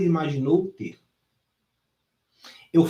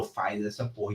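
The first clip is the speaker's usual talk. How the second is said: hushed, low order; low command; 8 semitones lower, 12 dB quieter than usual. Retold or imitated. Trainer pushing student to lift, low and hushed. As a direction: mutter it as a dare. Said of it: "Eu falo".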